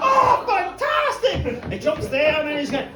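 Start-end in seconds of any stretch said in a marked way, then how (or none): 0.1-1.7 higher, louder and slow; boy cheering the fart; overjoyed cry. Acted. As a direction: stretch out the delight. Boy thrilled.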